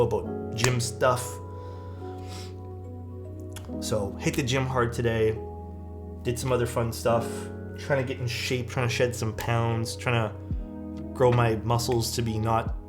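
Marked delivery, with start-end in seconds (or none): none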